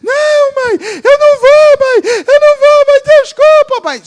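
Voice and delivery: high, screaming fake pleas; hammed-up begging. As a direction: shout theatrically to fool mom. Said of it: "Eu fazia teatro".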